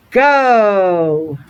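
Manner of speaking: usual speaking voice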